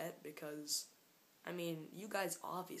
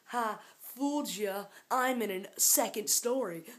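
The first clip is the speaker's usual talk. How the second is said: loud, high triumphant gotcha; playful shouted taunt; gleeful gloating; 4 semitones higher, 9 dB louder than usual. Pretend. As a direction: crow over the prank. Gloating after tricking viewers.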